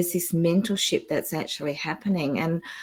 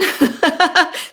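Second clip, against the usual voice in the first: teary laugh; 13 semitones higher, 7 dB louder than usual. Real, not acted. Emotional laugh through tears.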